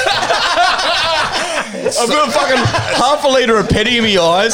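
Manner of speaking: high, loud hammed-up complaint; mock protest. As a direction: rant in pretend outrage for laughs. Mock complaint of doused man, joking.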